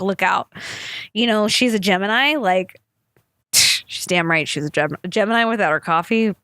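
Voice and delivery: ordinary talk, nothing marked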